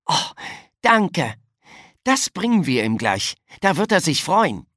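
pleased, bright lift in pitch; happy, eager child; beaming thanks. A narrator's reading. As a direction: sound delighted to be given the errand. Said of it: "Philipp strahlte".

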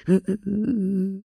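a bright high little laugh. A narrator's reading. Narrator laughing between lines, high. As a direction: laugh it off lightly.

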